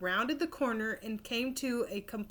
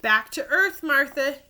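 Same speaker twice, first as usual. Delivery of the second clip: louder urgent yell; 4 semitones higher, 7 dB louder than usual. Acted. Rob calling his cow off the moon exhibit, loud yell. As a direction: call her back across the room. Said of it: "Rob yelled".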